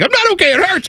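put-on very high, protesting little-kid voice; imitated child's squeaky complaint; upset child. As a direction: whine in protest like a hurt child. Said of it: "she's like".

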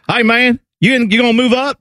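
high, exaggerated Ohio vowels; Central Ohio accent. Host voicing a stranger in the DMV line, high Central Ohio accent. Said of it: "the person next to you be like".

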